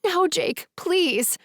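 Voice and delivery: high, thin, desperate begging; high frantic plea. A narrator's reading. Naomi begging Jake off the tracks, frantic high plea. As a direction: plead high and thin, panicking. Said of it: "my voice high and thin".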